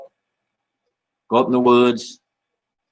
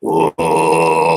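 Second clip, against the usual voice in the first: dropped-down chant tone; 5 semitones lower than usual. Shown as a demonstration. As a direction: drop to a deep, resonant "oh" and sustain it. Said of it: "Drop that down a little".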